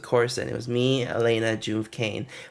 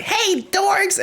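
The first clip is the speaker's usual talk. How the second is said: put-on high, sing-song kid taunt tossed down from above; mock a giggly little kid calling names. Little kid taunting tourists from upstairs, high sing-song. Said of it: "giggling and running around and like".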